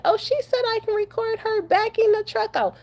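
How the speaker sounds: high, whiny mock voice of the man making excuses; mocking him. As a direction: parody his excuse in a high put-on voice. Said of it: "He's gonna say".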